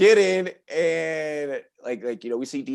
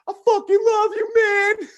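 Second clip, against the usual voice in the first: put-on drunk gushing; slower than usual. Drunk friend gushing to Will.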